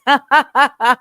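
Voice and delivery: loud burst of laughter. Real, not acted. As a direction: cackle at the joke. Laughs at own earthquake joke.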